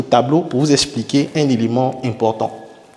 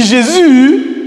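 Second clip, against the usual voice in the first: high, loud, drawn-out "Jésus"; exclaimed intonation; 8 semitones higher, 5 dB louder than usual. Shown as a demonstration. Teacher contrasting two takes for a class, loud exclamation. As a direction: cry out Jésus, lifting and holding the end. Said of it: "vous voyez que c'est pas pareil".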